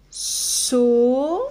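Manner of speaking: "So" held long with a hissed s; drawn-out syllable. Shown as a demonstration. Teacher sounding out soleil, drawn-out "So". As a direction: stretch out "So" as one isolated syllable. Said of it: "Alors, on y va".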